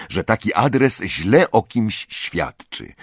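usual speaking voice

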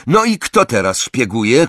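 loud taunting shout; a boy yelling at the girl spying on them. A narrator's reading. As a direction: throw it back at her loudly. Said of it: "krzyknął".

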